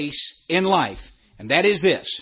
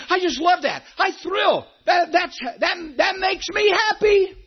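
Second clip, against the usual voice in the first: delighted; 10 semitones higher than usual. Real, not acted. Pastor telling the flock what thrills him, excited and high. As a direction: pitch leaps up with glee. Pastor gushing about church joy.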